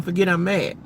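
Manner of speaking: ordinary delivery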